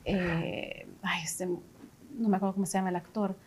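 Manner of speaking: under the breath, unvoiced; whispering. Real, not acted. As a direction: whisper it to yourself while recalling. Trying to recall an actor. (susurrando mientras intenta recordar)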